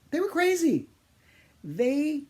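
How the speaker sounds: exasperated outburst. Real, not acted. Exasperated outburst about the kids.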